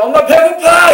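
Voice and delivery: put-on high, loud child's cry of hunger; child yelling for mom. Preacher as a hungry child shouting to his mother, high and loud. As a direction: yell it like a starving kid.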